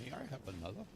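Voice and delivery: put-on pleading English orphan accent, pitch swooping; amateur English accent; Oliver Twist. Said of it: "except for Oliver Twist".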